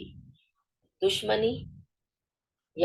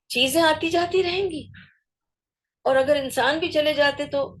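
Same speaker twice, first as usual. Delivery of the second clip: impassioned; 7 semitones higher than usual. Real, not acted. Impassioned point about loss.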